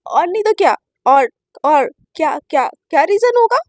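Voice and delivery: loud, halting, defensive bursts; nervous stammer. A narrator's reading. Shivani insists to Naina there is no other reason, nervously stammering. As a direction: repeat words nervously, insisting too hard. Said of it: "Shivani एकदम घबराने लगती है".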